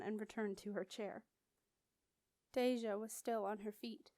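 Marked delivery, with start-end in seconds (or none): none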